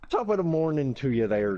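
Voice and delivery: a proper stage-Irish top of the morning; Irish accent. Shown as a demonstration. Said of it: "much better that second attempt".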